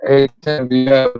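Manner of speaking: ordinary talk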